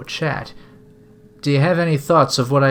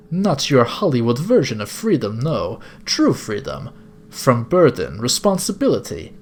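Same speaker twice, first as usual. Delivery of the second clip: grand, measured captor with a put-on accent; theatrical older gentleman villain; bad-accented villain. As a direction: preach it like a courteous cult leader. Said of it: "sorry if this is a bad accent".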